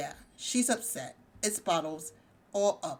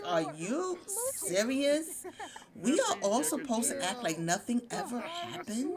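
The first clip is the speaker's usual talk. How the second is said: acted disbelief; 5 semitones higher than usual. Gemma jumping into the family argument, acted disbelief. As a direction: play an indignant relative joining the fight. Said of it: "and here comes Gemma".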